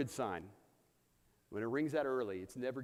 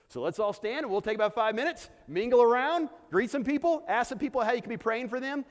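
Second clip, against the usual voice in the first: loud, high, rallying announcement; calling out instructions; 8 semitones higher, 10 dB louder than usual. Real, not acted. Pastor telling congregation to stand and mingle, loudly. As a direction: project over the room to get everyone moving.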